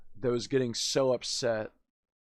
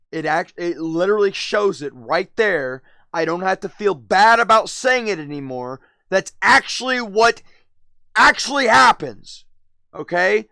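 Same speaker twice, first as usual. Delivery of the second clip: getting louder and higher with each claim; rising rant; 6 semitones higher, 9 dB louder than usual. Real, not acted. Streamer building up his rant to chat, louder and higher.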